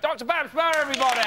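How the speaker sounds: high, lively host intro; big intro for the guest; excited announcement. Acted.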